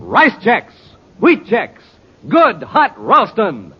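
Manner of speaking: normal talking voice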